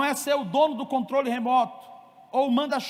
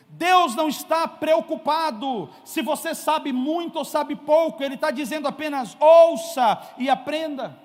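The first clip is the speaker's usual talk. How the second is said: firm preaching; 2 semitones higher, 3 dB louder than usual. Acted. Preacher drives home fatherhood lesson to congregation, emphatic.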